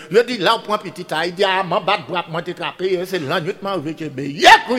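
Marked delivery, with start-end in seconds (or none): 4.4-4.8 high loud shout to the crowd; storyteller's shouted cue; shouted call. Acted. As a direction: shout the call so they answer. Call-and-response to audience.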